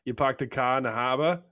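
put-on Boston accent, dropped r's; hammed-up Boston accent, no r. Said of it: "What about my Boston accent?".

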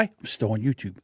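dropped low and soft, conspiratorial; hushed. Real, not acted. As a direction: keep it hushed like a secret.

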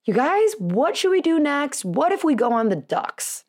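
eager, high-pitched hype to the family; imitated upbeat hype man. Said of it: "trying to do my best hype man".

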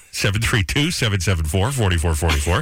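number read with lifting ends; announcer read, rising. Acted. Radio host repeating the call-in number, rising. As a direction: repeat it with each group rising.

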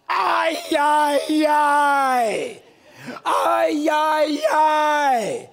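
loud, far-carrying wails of ay; woman yelling in labor. Retold or imitated. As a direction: belt out each ay like a woman in labor. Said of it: "this is how I know her ethnicity".

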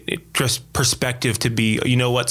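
ordinary delivery

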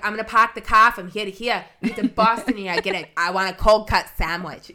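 loud, exaggerated New England accent; hammed-up Boston accent, punching it out; Maine and Boston accent. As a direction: drop the r's like a Bostonian, loudly. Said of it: "And then you get up to Maine".

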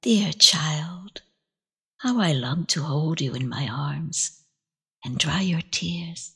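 put-on the grandmother's ghost comforting the child; tender grandmother. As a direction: hush it like a loving grandmother. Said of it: "saw the face of her grandmother".

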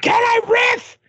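high shouted question; hammed-up villain yell; mock villain shout. Imitating villain riffing.

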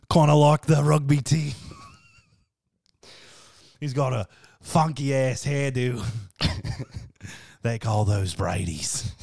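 mock Australian wildlife spotter, amused; Australian accent with laughs between phrases; Steve Irwin. Host teases friend as a wild species, Australian accent. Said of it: "How would Steve Irwin describe".

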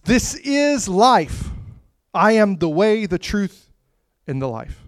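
high, very slow, drawn-out proclamation; Jesus declaring himself the life, slow and high; voicing Jesus. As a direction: let each word ring out as Jesus.

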